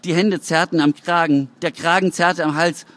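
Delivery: ordinary delivery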